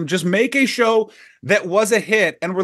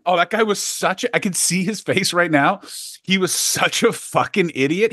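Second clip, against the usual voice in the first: ordinary talk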